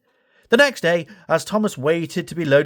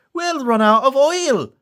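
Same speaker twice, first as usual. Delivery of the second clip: high, odd engine voice; Bash voice; 12 semitones higher, 4 dB louder than usual. Acted. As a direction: whine it as the worried loco. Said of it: "said Bash".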